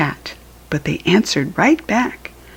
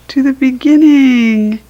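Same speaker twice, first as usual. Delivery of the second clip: excited; 8 semitones higher, 7 dB louder than usual. A narrator's reading. Lively payoff of repetition.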